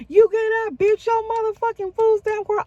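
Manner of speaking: high-pitched outraged outburst; retold furious tirade, pitch shooting up; going off. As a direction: blow up at him in disbelief. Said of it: "I just started going off".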